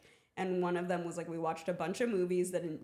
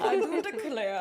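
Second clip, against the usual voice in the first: higher, louder Southern lawyer drawl; 9 semitones higher, 6 dB louder than usual. Pretend. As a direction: declare it like a courtroom gent from the South. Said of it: "That is my favorite bit".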